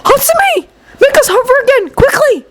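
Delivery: high, urgent shout; urgent cry. Acted. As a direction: cry out in a hurry. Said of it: "Midoriya had cried".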